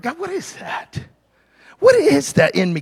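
pleading question. Retold or imitated. Retold plea to God while walking.

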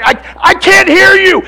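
high, loud cry into the storm; hammed-up Peter straining to hear; mock shout into wind. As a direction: shout over the roaring wind.

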